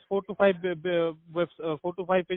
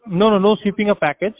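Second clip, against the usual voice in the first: louder, firm no no no; loud emphatic denial; 3 semitones higher, 9 dB louder than usual. Real, not acted. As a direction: cut in firmly to correct them. Correcting a misunderstanding, emphatic.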